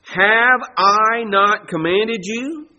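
each word drawn out and weighed; slow solemn reading. Shown as a demonstration. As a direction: read it slowly as God's charge. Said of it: "Verse nine".

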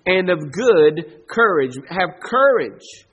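each word drawn out, firm; slow commanding charge. Acted. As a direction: command them slowly. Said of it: "I'm commanding you".

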